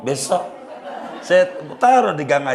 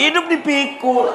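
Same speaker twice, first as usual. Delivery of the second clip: much higher, laughing in disbelief; mock the husband lugging a live cow; 14 semitones higher, 4 dB louder than usual. Villager laughs at the husband carrying the cow, high and incredulous.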